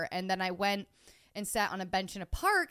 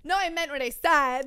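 mock defiant, voice raised high; 6 semitones higher, 7 dB louder than usual. To her boyfriend, a stubborn mock-defiant stand. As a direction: dig in and refuse to back down. Standing your ground after a fight.

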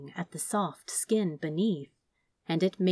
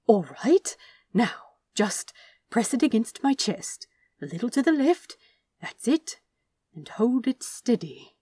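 Aziraphale coaxing, pitched higher; gentle angel voice; 4 semitones higher than usual. Acted. Aziraphale guides the knife.

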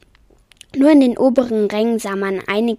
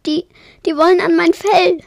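high, loud, stammering alarm; anxious, higher-pitched outcry; frightened; 8 semitones higher, 6 dB louder than usual. A narrator's reading. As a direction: stumble on the first word, then cry out in fear. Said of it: "sagte Yeti ängstlich".

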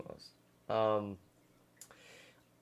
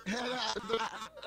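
helpless laugh; 10 semitones higher, 10 dB quieter than usual. Real, not acted. Laughs at broken stream.

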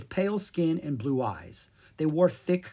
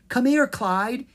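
eager coaxing; 10 semitones higher, 6 dB louder than usual. Acted. Zed coaxing the monkey.

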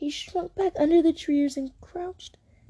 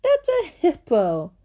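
ordinary delivery

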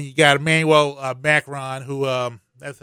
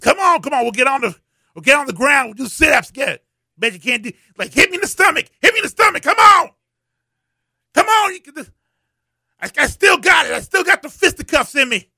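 put-on high, loud, shouting old-man challenge to fight; mock old man bravado; 10 semitones higher, 5 dB louder, faster than usual. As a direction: ham up an old man daring someone to punch his stomach.

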